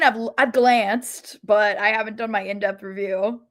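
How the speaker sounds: usual speaking voice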